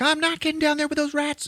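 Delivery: very high mock girl's protest; hammed-up squealing refusal; panicked refusal. Girl on a wing refusing rats, mock panic.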